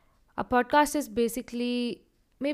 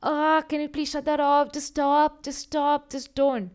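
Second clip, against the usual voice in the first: higher, louder embarrassed begging; cringing plea; 6 semitones higher, 7 dB louder than usual. Pretend. Podcaster acting out cringing at his podcast playing aloud, pleading. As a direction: beg them to stop, squirming. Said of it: "Or am I supposed to be cringing?".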